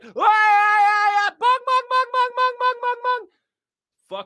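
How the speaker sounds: very high, loud wail into clanging bongs; mock wailing and bells. Mocking how loud religion is outside, wailing racket. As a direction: blare like minaret and bell tower together. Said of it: "But on the outside, they're like".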